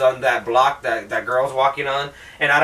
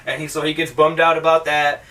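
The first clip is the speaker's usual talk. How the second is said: normal talking voice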